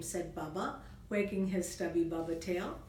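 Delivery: plain reading voice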